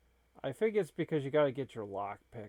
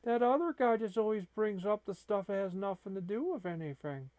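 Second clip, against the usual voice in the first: whiny kitty voice; 7 semitones higher than usual. Retold or imitated. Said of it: "And he's saying".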